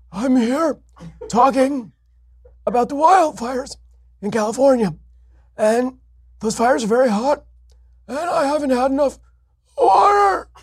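put-on very high, slow, frail old governor ending in a cry; Jerry Brown impression. Host doing his Jerry Brown bit, frail high voice breaking into a wail. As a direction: quaver like an ancient governor, then wail. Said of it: "my Jerry Brown impersonations".

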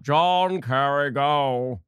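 very slow, drawn-out put-on voice; mock pompous cue. Host cueing up John Kerry's clip, mock pompous. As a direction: drag out the name in mockery.

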